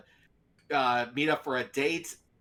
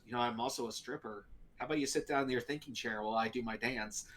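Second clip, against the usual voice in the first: low, soft, quick seductive voice; sultry date; 5 semitones lower, 9 dB quieter, faster than usual. Retold or imitated. Date offering Steve a dance, low and quick. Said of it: "And she goes".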